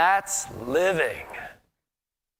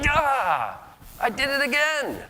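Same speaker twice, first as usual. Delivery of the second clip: much higher, exasperated self-reproach; exasperated groan; 9 semitones higher, slower than usual. Retold or imitated. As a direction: throw up your hands at yourself. Exasperated at himself.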